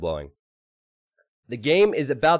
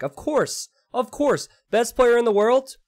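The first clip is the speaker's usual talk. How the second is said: rising, louder mock hype; excited; 13 semitones higher, 5 dB louder than usual. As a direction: build it up high and loud. Hyped jab at best player.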